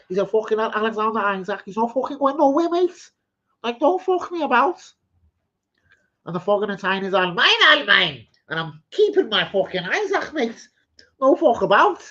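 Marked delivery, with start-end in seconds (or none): 0.3-4.8 high-pitched, shaky-grasp Geordie impression; rough Geordie accent. Quoting a Newcastle fan for the stream, rough Geordie accent. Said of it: "I can't really do a Jordan, Geordie accent that well". 7.1-12.0 high, loud amateur Geordie impression; rough Geordie accent. Voicing a fan keeping his Isaac, rough Geordie accent. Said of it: "Geordie accent that well".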